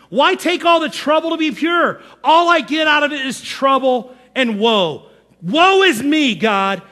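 high, woeful mock lament to God; mock whiner. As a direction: wail the complaint, breaking up the last words. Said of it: "King David was a whiner".

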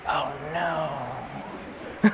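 low, soft, sinking "oh no"; hushed dread. Retold or imitated. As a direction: realize you're doomed, under your breath. Dad summons him downstairs.

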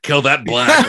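laughing mock villain line, pitch jumping up high; quoting villain. Podcast host quoting the movie villain, laughing mimicry. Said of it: "or whatever he says".

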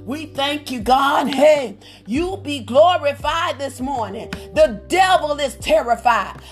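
ordinary talk

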